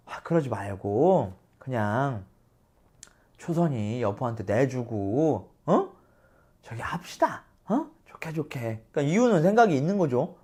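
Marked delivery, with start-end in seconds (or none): 0.1-8.6 작고 느리게 속삭이듯 회유; 조용히 구슬리듯; 낮게 달래기. Retold or imitated. 이유가 낮게 달래는 장면. (작은 소리로 달래는 참모)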